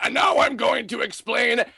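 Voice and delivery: booming mock-epic announcement; Leonard Nimoy Xehanort. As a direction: ham up the all-knowing villain explaining everything. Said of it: "Leonard Nimoy Xehanort coming in and be like".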